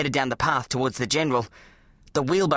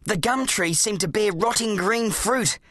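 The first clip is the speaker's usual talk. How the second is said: ordinary delivery, nothing marked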